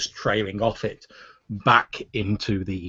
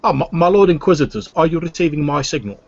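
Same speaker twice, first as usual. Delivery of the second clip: higher, louder obsequious alien voice; Weequay alien voice; 4 semitones higher, 6 dB louder than usual. Acted. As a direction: fawn over the lords in a raised alien voice. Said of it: "the Weequay's voice says".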